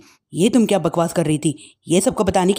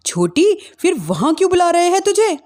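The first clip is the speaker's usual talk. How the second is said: urgent question; 10 semitones higher than usual. Acted. Mother pressing younger daughter.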